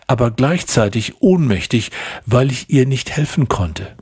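ordinary delivery, nothing marked

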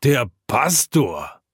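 slow, drawn-out exclamation of surprise; startled group call. A narrator's reading. Whole drinking round echoes the first man, startled call. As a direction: echo the startled call, stretching it out. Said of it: "ruft ebenso die Runde".

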